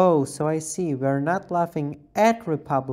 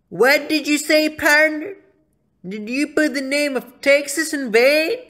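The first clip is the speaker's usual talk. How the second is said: mock outrage; 9 semitones higher, 6 dB louder than usual. Offended cowboy role, outraged.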